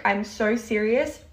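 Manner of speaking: ordinary talk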